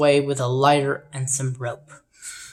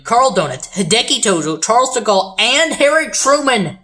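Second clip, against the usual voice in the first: building excitement; 7 semitones higher, 5 dB louder than usual. Real, not acted. Game host lists the explosive's victims, mounting excitement. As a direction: climb higher and louder through each name.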